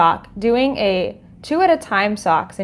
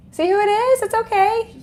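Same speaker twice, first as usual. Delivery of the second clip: high dog-talk voice; 10 semitones higher than usual. Real, not acted.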